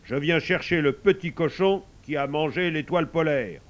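firm, projected character voice; assertive sun. Acted. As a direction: play an insistent, loud sun.

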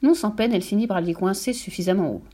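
ordinary delivery

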